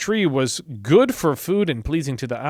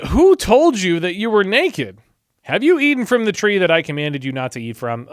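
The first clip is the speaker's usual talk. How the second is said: stern God; 5 semitones higher, 7 dB louder than usual. Acted. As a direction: demand an answer from Adam. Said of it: "different cadences".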